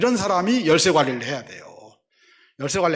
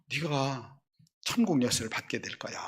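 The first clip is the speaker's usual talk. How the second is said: soft quoted promise; 9 dB quieter than usual. Acted. Quoting Jesus' promise.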